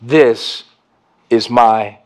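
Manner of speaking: low, slow and grave, each word weighed; solemn hushed words. Acted. Preacher voicing Jesus at the table, low and grave. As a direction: lay the bread words down slowly.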